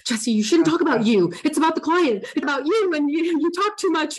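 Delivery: high, rising panicked self-scolding; freaking out. Retold or imitated. New coach scolding herself mid-call, panicked and high. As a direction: scold yourself in a rush of panic. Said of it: "I started freaking out".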